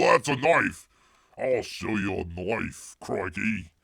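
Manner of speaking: badly faked Aussie, drawn out; bad Australian accent. Host runs out of known Australian phrases, bad Australian accent. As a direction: ham up an Australian tough-guy line. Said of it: "that's all that Bear knows in, um, Australian".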